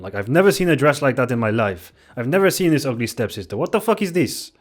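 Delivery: put-on a failed Norwegian accent, indignant and rising to a yelp; attempted Norwegian accent. Imagined Norwegian viewer complaining about the dresses, bad Norwegian accent. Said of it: "I can't really do a Norwegian accent".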